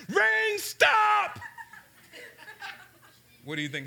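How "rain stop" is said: high, loud commanding yell at the rain; a little boy yelling at a storm; child's shout. Retold or imitated. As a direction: order the rain to stop at full voice. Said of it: "and I said".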